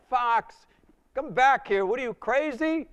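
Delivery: high, loud echoing shout; distant calling. Retold or imitated. Friends' voices echo from the party room, loud high calling. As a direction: call from far behind.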